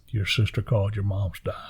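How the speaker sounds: soft, half-whispered retelling; near-whisper, grave and careful; hushed bad news. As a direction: break it gently, voice sinking to almost nothing. Wife breaks bad news, retold.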